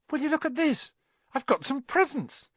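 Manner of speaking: high-pitched, excited discovery; delighted surprise. A narrator's reading. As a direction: exclaim over an unexpected pile of presents. Boy finds unexpected presents.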